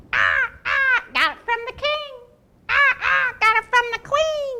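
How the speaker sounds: high, loud squawking bird. Acted. Toucan boasting about her gifts, high squawking.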